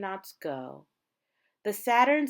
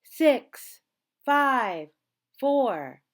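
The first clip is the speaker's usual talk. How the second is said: loud, higher countdown shout; countdown call; 5 semitones higher, 8 dB louder, much slower than usual. A narrator's reading. Reading to children, shouting the countdown as it nears zero. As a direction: build the tension as the count drops.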